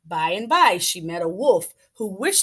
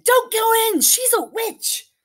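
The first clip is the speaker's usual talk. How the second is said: high, loud, slowed warning cry; urgent chicken warning; 5 dB louder than usual. Acted. Chicken interrupting Hansel and Gretel, high urgent cry. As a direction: burst in to stop them.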